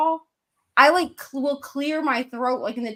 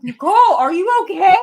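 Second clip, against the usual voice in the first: loud, higher alarmed check-in; very concerned; 5 semitones higher, 9 dB louder than usual. Retold or imitated. Checking on a rough-sounding friend, very concerned. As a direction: blurt it out with urgent concern. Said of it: "She was very concerned".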